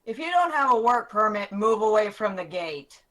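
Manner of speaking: loud, firm command; stern guard. Acted. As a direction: bark the order at the gate. Guard orders him away.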